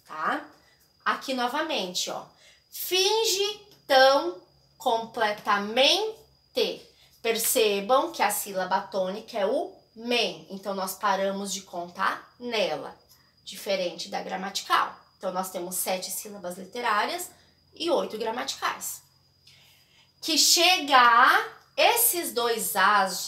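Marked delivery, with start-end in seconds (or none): 2.7-6.9 slow, drawing out each syllable of "Finge tão completamente"; teacher scanning a verse aloud, slowly; slow scansion reading. Shown as a demonstration. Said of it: "quando eu falo o verso, sai um som só". 20.2-23.3 slow, spelling out each syllable of "Que chega a esses dois as"; teacher showing the merged vowels, slowly; slow scansion reading. Shown as a demonstration. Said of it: "quando eu falo o verso, sai um som só".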